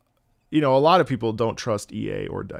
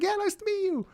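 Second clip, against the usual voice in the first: very high, thin meet-and-greet voice; put-on polite greeting; 3 dB quieter than usual. Streamer greets a fan while needing the toilet, high strained greeting. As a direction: greet them in a squeaky high voice.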